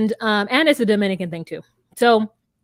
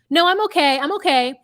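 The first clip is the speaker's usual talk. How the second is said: raised, louder called-out brush-off; insistent mock refusal; 6 semitones higher, 5 dB louder than usual. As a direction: act out stubbornly calling back through a door. Retold refusal called through door.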